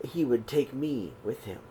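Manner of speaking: ordinary delivery, nothing marked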